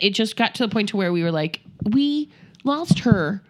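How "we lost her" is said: very slow, higher, spaced-out words; emphatic admission. Retold or imitated. Parents admitting they lost their child, slow disbelief. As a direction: let each word land slowly.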